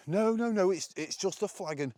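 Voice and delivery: mimicking people who insist it's just a flag; mocking dismissive voice. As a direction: parody people brushing off the point. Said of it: "how people argue it".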